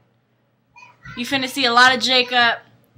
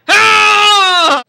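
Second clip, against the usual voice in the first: long, loud, high growling roar; imitated growl; 11 semitones higher, 14 dB louder than usual. Storyteller mimics Edward growling at the men, drawn-out loud roar. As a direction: roar and growl to scare off a crowd. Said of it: "Growling at them and shit".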